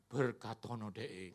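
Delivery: low, slow, solemn blessing; the voice that the devil would never use. Pretend. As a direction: intone it slowly and deeply like a blessing. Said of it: "Nggak mungkin suaranya gini".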